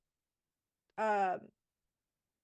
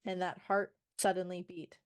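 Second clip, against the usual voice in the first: normal talking voice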